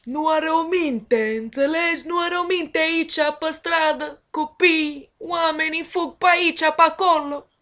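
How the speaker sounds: Romanian spoken with a high, sing-song Italian accent; animated mock-Italian Romanian. Shown as a demonstration. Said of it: "Take some Romanian and make it sound Italian".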